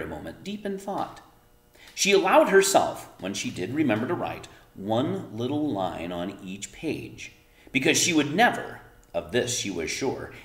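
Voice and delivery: expressive read-aloud storytelling; story narration. A narrator's reading. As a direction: read it to the kids like a bedtime story.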